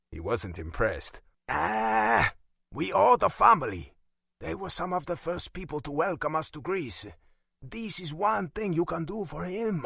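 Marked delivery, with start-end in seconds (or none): 1.5-9.8 put-on high, accented exasperation; insistent Italian father. A narrator's reading. Father urging his son to do one thing, exasperated Italian accent.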